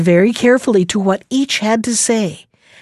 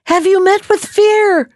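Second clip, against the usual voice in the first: shouting; 10 semitones higher than usual. A narrator's reading. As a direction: call out across the water. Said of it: "shouted the boy".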